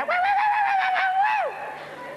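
high, loud yapping dog; dog barking. Acted. Comedienne acting out the dog in her anecdote, high loud barking. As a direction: imitate a little dog barking shrilly.

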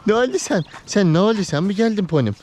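high, loud and sing-song, cooing at a pet. Real, not acted. Man greeting his dog Pony at the door, high cooing baby talk. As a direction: greet the dog like a delighted owner.

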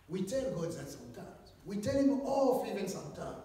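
normal talking voice

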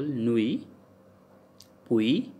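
"nuit, puis" with a "wee" glide; French "ui" sound. Shown as a demonstration. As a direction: silent final consonants in "nuit, puis", ending on "wee". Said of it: "Sounds like ui".

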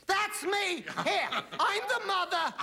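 high mock proud mother, half laughing; mother in the audience. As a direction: call out proudly from the crowd. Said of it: "she stood and said".

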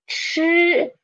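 chi high and level, vowel not like "ee"; blended syllable. Shown as a demonstration. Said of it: "put them together is".

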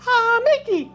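very high cartoon mouse squeak; high-pitched mascot impression; Mickey Mouse. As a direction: try out a falsetto mouse voice. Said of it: "I can work on Mickey".

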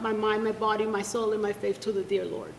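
ordinary talk, nothing marked

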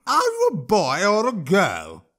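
high, slow troll taunt; high mocking troll voice. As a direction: tease the boy as a sneering troll. Troll mocks the boy.

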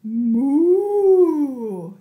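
loud booming moo; cow moo. Acted. Cow arrives in story.

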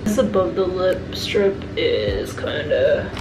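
fingers pressing at the lips; speaking through a sheet mask. Real, not acted. Seen 1: Wet sheet mask on face, hands pressing lip strip while speaking.

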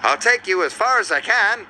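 high, friendly bus character; Bertie. A narrator's reading. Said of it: "said Bertie".

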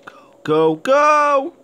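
building to a high, loud shout; urgent go calls. Real, not acted. Gamer running from danger, urgent shouting. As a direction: urge yourself on as you flee. Fleeing in game.

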